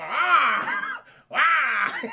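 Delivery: loud, high wailing cry; imitated howl. Hooded hermit scaring off raiders, loud wailing howl. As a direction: howl like a creature in a robe.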